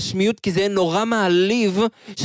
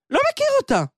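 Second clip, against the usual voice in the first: defiant outburst; much faster than usual. Acted. Son protests to his father.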